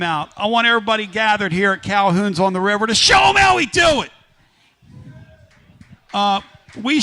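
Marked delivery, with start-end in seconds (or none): 2.9-4.1 loud, higher-pitched call to the crowd; rallying shout. Real, not acted. Host to everybody gathered at Calhoun's, rallying shout. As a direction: whoop it up for the gathering.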